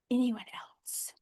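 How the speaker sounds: slow, drawn-out whisper; hushed, lingering whispered question. Real, not acted. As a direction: whisper it out slowly to the silent group. Whispered repeat to silent group.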